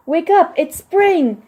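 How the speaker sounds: slow, high, loud wake-up call; shouting. Acted. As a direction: stretch it out to rouse a sleepyhead. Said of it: "shouted Frog".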